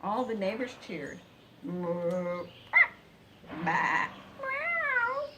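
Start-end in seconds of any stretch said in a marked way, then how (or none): 1.6-2.5 loud lowing moo; cow moo. Acted. Neighbors' animal cheers. 3.6-4.1 loud bah bleat; sheep bleat. Acted. Neighbors' animal cheers. 4.4-5.3 very high, loud meow; cat meow. Acted. Neighbors' animal cheers.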